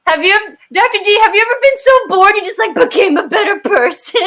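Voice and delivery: excited mock-Canadian questioning; Canadian accent. Winner asking the host a question, quick Canadian accent. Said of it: "but only in a Canadian accent".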